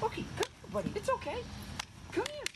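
very high, soft puppy talk; squeaky praising a dog. Real, not acted. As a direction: coo at the dog in falsetto. Trainer praising dog off camera.

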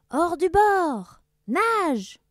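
high, slow, loud shout; yelled order. Acted. Captain yells to his crew. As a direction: bellow the wrong order. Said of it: "il hurla à son équipage".

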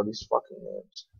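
under the breath, whispered; whispered grumble. Real, not acted. Gamer annoyed at quest names, whispering a grumble. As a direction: whisper the complaint to yourself.

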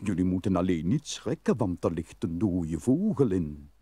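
a hint of an old mole inviting them in. The mole's voice in the tale. (een mol die lager spreekt)

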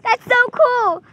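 slow, loud, delighted; excited. Real, not acted. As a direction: savor how cool it is. Child delighted.

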